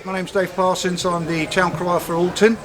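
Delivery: normal talking voice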